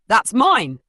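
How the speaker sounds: rasping cry. Acted. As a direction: rasp out a desperate claim. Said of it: "she rasped, her voice hoarse".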